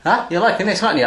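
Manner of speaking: usual speaking voice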